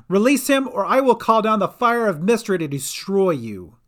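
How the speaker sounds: high, loud threat; commanding. A narrator's reading. Sister Cat threatens Dolly from the deck, commanding. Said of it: "commanded Sister Cat".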